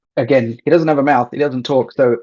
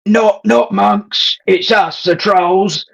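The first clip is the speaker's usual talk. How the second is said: higher, throaty bad Aussie troll accent; sling a bad Aussie troll voice at the monks; 6 semitones higher than usual. Player mimicking the head troll to the hidden voice, bad throaty Aussie accent. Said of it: "I wanna mimic the trolls... Thomas, the head troll".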